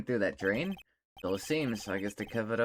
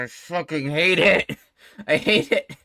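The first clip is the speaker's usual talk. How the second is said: loud, high, slowed-down complaint with laughter; exasperated outburst; 6 semitones higher, 10 dB louder, slower than usual. Real, not acted. Player who hates this character's voice, loud exasperated outburst. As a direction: burst out loudly, half laughing.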